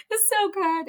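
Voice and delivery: high, loud rush of joy; gushing delight. Real, not acted. Friend gushing about a brunch that moved her, high and loud. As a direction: squeal how good it felt.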